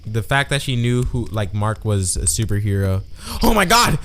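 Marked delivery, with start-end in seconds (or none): 3.4-4.0 high, loud fake astonishment; hammed-up disbelief; mock shock. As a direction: gasp it out as a huge fake revelation. Feigns shock at obvious spoiler.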